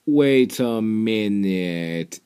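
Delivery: ordinary talk, nothing marked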